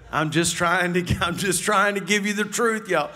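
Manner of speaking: normal talking voice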